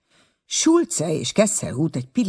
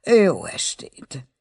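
slow, higher, drawn-out good evening; dismissive farewell; 4 semitones higher, much slower than usual. A narrator's reading. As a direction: close the conversation with a drawn-out farewell. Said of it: "mintegy lezárva a beszélgetést".

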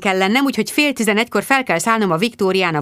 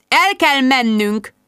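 slow, drawn-out mystic pronouncement, higher; mystical spooky voice; 4 semitones higher, slower than usual. A narrator's reading. Jude startles friends with a premonition, mystical drawl. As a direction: intone it like a mystic seer. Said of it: "misztikus marcsa hangon".

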